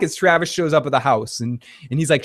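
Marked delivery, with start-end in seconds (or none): none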